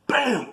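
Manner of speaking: high mock punch noise; punch sound effect. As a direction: spit out the impact sound. Imitating the punch landing.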